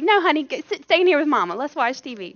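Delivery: loud, high mock coaxing; imitated overprotective mom. Overprotective mom refuses her kid, loud high mock voice. Acts out frantic mother refusing.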